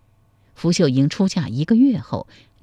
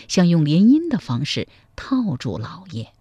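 ordinary delivery

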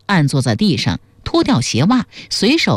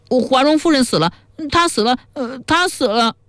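put-on wailing like a child; 8 semitones higher than usual. A narrator's reading. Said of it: "居然像个小孩子那样哇哇大哭起来".

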